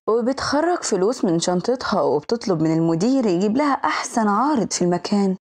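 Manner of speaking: ordinary talk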